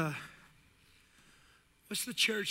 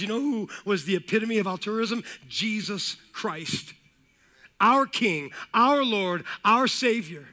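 loud, high, driving pulpit cadence; building sermon call; full-force preaching; 5 semitones higher, 7 dB louder than usual. Acted. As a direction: preach it hard to the congregation.

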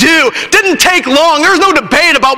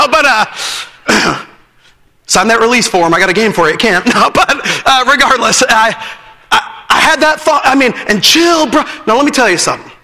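ordinary talk

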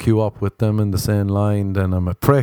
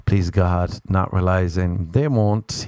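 ordinary talk, nothing marked

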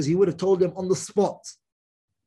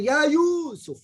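calling out; 9 semitones higher, 4 dB louder, much slower than usual. Shown as a demonstration. Calling out Yusuf.

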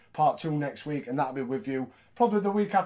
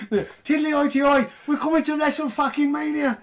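hammed-up bad Irish accent, higher and louder; a hammed-up stage-Irish jig of a line in a bad Irish accent; clumsy Irish accent, cheering; mock Irish accent; 9 semitones higher, 3 dB louder than usual. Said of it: "we can't do Irish accents".

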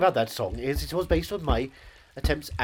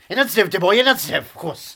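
pompous luvvie; 5 semitones higher, 11 dB louder than usual. Acted. Pompous actor insisting loudly.

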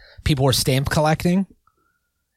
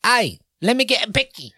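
high-pitched put-on character voice; hammed-up high voice, asking for a treat; playful, high put-on plea; mock slang request; 10 semitones higher than usual. Acting out Australian slang in use.

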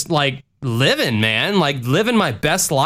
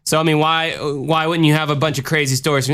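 normal talking voice

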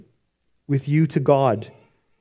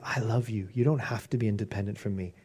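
much softer and lower; tender reassurance; 6 semitones lower, 11 dB quieter than usual. Retold or imitated. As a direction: hush it like comforting a child. God's loving reassurance.

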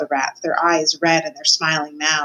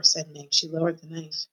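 ordinary delivery

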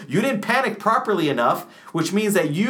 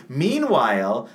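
ordinary talk, nothing marked

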